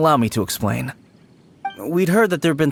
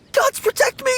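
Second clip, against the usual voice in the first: high-pitched panicked plea; frightened, high cry for protection; terrified prayer. Acted. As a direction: beg the gods to save you. Man terrified of supposed ghost.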